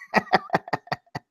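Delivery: sudden falsetto laugh; high squeal of laughter. Real, not acted. Laughing at his toilet paper moral, high squeal.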